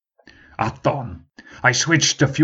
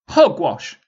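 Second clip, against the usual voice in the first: scornful shout; 7 semitones higher, 4 dB louder than usual. Acted. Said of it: "someone shouted".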